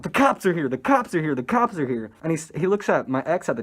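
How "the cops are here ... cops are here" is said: repeated mock-panicked alert, starting high and settling; mildly hammed-up panic; imitated freak-out. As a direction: imitate someone raising the alarm over and over. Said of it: "he starts freaking out".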